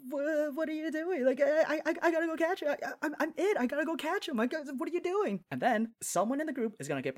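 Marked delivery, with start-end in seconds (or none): 0.1-5.4 much higher, stammering panic with laughs; a boy cornered by girls, hammed-up panic; imitating a panicked middle school boy stammering to get away; mock freaking out. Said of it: "he's gonna start freaking out".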